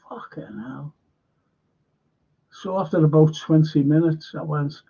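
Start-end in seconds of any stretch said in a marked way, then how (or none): 0.1-0.9 whispered thought. Retold or imitated. Retold thought while watching film.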